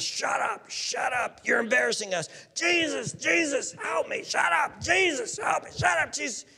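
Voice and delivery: high, strained crowd yells; overlapping-style cries in quick bursts; chaotic shouting. Acted. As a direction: mimic a clamouring crowd, not at full volume. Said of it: "in the midst of this sort of".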